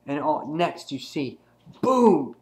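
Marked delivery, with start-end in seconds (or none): 1.8-2.4 loud, high burst of a sound word; imitated impact. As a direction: punch it out like a sudden blow.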